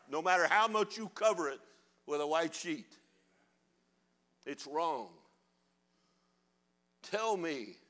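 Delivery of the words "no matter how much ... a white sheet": ordinary delivery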